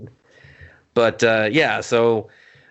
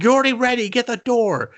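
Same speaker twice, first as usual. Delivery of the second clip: high-pitched mock yell; imitated dad losing patience; exasperated dad; 12 semitones higher than usual. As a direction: yell back like a fed-up father. Dad argues with daughter over door.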